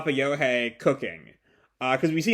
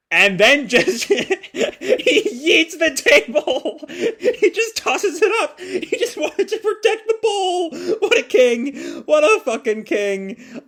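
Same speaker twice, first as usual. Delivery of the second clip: high, squealing laughter through the words; cracking up as she tells it; real giggling fit; laughing hard; 13 semitones higher, 4 dB louder, slower than usual. Real, not acted. Laughing at the table toss.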